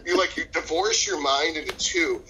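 ordinary talk

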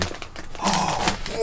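a sudden, breathy gasp of shock; sharp gasp. Real, not acted. Vlogger gasping at a rare find, real sharp gasp. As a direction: gasp like you can't believe your eyes. Finds a [name] item.

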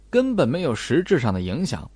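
plain reading voice

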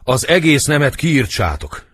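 high, loud order; acted rallying cry; offstage command shout. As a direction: shout a ruthless command from outside. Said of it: "kívül".

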